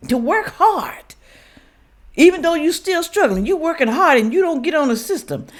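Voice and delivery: high-pitched, indignant rant at the critics; heated rant. Real, not acted. Speaking to viewers who mock hard workers, high heated rant. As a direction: pitch it way up and let the indignation pour out. Ranting at people who tease them.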